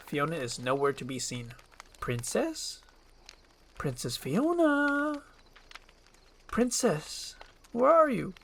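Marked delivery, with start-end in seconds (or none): 2.0-8.3 high, tentative calling out; wary singsong search, pitched up. Acted. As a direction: call into an empty place, drawing out the name. Donkey searches for missing princess.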